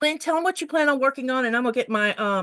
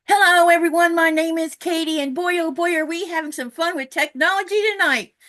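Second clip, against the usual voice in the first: hyped and loud, words spilling out; too much caffeine energy; wired; 5 semitones higher, 4 dB louder than usual. Real, not acted. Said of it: "I sound a little wired".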